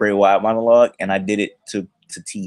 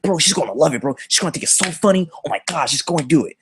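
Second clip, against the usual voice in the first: higher-pitched giddy egging-on; hyped-up homeboy; 6 semitones higher than usual. Retold or imitated. Homeboy egging him on about the poem, hyped up. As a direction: imitate a friend bursting with anticipation.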